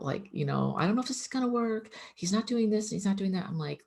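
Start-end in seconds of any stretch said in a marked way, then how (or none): 0.8-3.5 fast, much higher anxious rush; sister's panicked phone call, mimicked fast and high; freaking out. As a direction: imitate the panicked sister, words tumbling out high. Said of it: "she'd call me freaking out".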